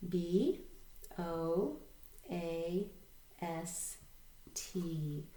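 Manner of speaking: slow, letter-by-letter spelling; spelling aloud. Shown as a demonstration. Teacher leading learners in read, spell, read, spelling aloud. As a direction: spell it out slowly, a gap after each letter. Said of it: "spell this word along with me".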